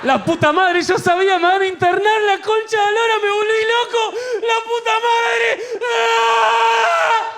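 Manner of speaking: high-pitched frantic yelling, rising into a scream; panicked meltdown. Acted. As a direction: lose it completely, climbing to a shriek. Panicked meltdown, thinks he went mad.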